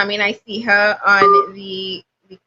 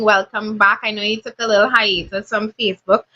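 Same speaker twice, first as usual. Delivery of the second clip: usual speaking voice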